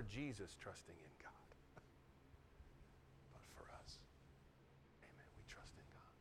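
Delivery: soft preacher's whisper; a preacher whispering to his congregation. Real, not acted. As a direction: lean in and whisper it.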